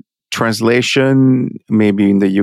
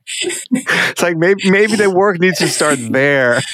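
real laughter running through the words, higher pitched; laughing; 7 semitones higher, slower than usual. Real, not acted. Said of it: "I'm laughing because".

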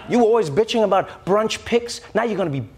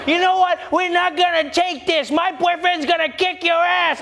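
put-on drunk woman picking a fight; 10 semitones higher than usual. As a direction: shriek it like a tipsy bar brawler. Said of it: "Where they're just like".

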